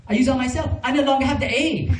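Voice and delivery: put-on high, insistent Vietnamese-accented mother; imitating mom. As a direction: mimic his mother protesting in her accent. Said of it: "So I said".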